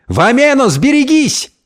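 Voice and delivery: high, urgent cry; alarmed warning. A narrator's reading. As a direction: warn someone of sudden danger. Друзья предупреждают о машине. (тревожный крик-предупреждение)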